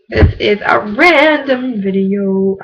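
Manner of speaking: drawn out, very slow. Shown as a demonstration. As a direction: stretch each word out slowly. Same sentence repeated very slowly.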